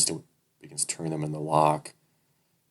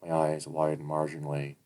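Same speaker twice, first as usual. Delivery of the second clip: usual speaking voice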